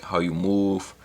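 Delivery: usual speaking voice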